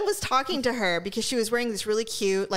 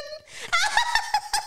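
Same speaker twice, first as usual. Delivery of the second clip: laughing. Real, not acted. Cracking up mid-story.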